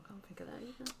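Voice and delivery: soft breathy whisper. Real, not acted. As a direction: whisper it under your breath. Tired quiz aside.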